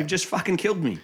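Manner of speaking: mild mock despairing rebuke; acted reproach. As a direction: accuse as an exasperated father would. Acts the Don scolding Sonny.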